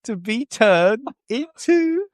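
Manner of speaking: slow, high, rising mock grandeur; hammed-up reveal. Drawn-out hammed build-up to reveal.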